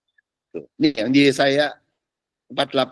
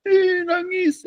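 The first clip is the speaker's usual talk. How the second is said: mock crying; much slower than usual.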